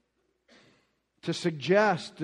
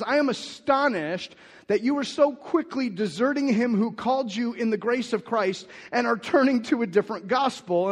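higher, louder declamation of Paul's rebuke; forceful scripture reading; 7 semitones higher, 6 dB louder than usual. Acted. Pastor reads Paul's astonished rebuke to the Galatians, loud and raised. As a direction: preach the rebuke with full force.

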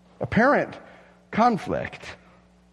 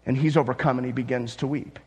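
usual speaking voice

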